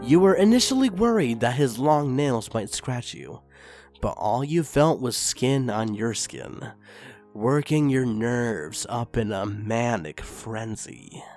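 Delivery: ordinary delivery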